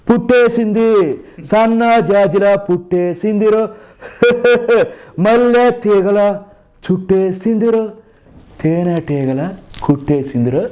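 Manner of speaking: high, drawn-out star voice with chuckles; Nagarjuna. Acted. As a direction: mimic a romantic film hero reciting lyrics slowly. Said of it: "అనగా నాగార్జున గారు".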